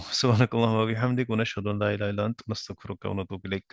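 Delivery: rapid, fading closing supplication; quick prayer recitation. Real, not acted. Teacher closing class with a prayer, quickly recited.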